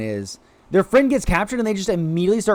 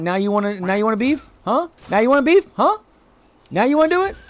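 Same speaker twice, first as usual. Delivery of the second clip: mock taunt; 8 semitones higher, faster than usual. Taunting fleeing enemy creature.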